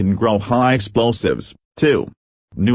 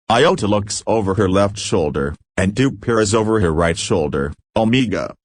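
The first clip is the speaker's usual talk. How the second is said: usual speaking voice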